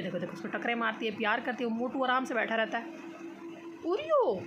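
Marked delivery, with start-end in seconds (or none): 3.9-4.4 high sing-song call of a name; calling the cat. Real, not acted. Owner calling out to her cat Oreo. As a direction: call out to the cat across the room.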